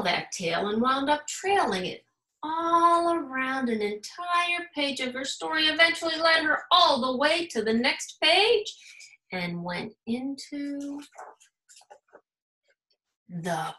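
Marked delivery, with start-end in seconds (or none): none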